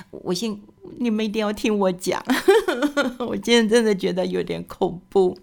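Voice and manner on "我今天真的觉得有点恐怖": nervous laugh. Real, not acted. Nervous laugh at scary story.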